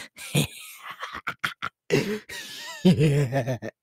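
long, high, breathy cackle; wheezing clown laughter in bursts; manic giggling. Acted. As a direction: dissolve into creepy delighted giggles. Delighted about favourite candy.

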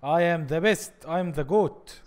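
very slow, drawn-out swagger; mock boast. Gamer boasting slowly in English after a perfect run. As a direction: savor each word like a champion.

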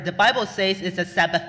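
normal talking voice

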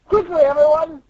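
high-pitched urgent orders; rally the crew before backup comes; urgent commander. Acted. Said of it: "And then he's like".

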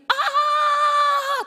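long, high, loud "aah" scream; imitated scream. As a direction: let out a sustained high scream, imitating someone falling. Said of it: "ada jeritan kayak bunyi". (menirukan jeritan panjang melengking)

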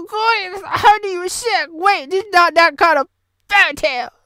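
ordinary delivery

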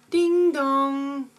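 sung two-note chime; singsong ding dong; doorbell imitation. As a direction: voice one drawn-out doorbell ring. Doorbell rings again in sketch.

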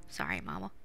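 ordinary talk

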